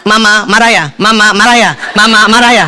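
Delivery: low, rattled-off question, three in a row; too-quick repetition. Retold or imitated. Husband told to ask wife three times, rushing it. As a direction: imitate asking in a rush without pauses. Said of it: "cepet banget".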